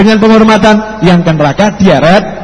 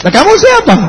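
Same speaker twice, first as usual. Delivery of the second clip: surprised. Acted. Acted dialogue, surprised cleric.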